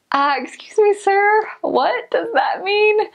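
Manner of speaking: high, slow, puzzled question; mock bewilderment; confused. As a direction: play the baffled outsider. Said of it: "And I was like".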